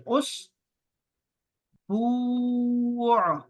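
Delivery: "اسبوع" stretched out very slowly and evenly; slow spelling-out. Shown as a demonstration. Teacher dictates "اسبوع" to children writing it in Roman letters, very slowly. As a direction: draw out "اسبوع" sound by sound for dictation. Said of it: "اس کو آپ نے Roman میں لکھنا ہے".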